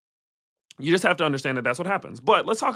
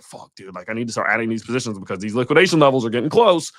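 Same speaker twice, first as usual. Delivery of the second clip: nervous trader; 3 semitones lower, 5 dB louder than usual. Retold or imitated. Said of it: "because they're like".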